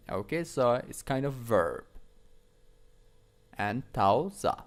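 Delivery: normal talking voice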